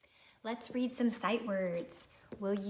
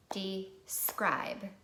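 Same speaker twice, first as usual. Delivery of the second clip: "Describe" in two slow, separate beats; clapped syllables. Shown as a demonstration. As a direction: pause between dee and scribe in "Describe". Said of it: "clap it with me again".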